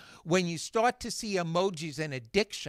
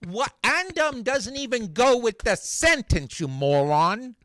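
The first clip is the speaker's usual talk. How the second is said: loud, high-pitched mock outrage; exasperated; 6 semitones higher, 7 dB louder than usual. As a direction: snap back in hammed-up disbelief. Acted exasperated reaction to filler words.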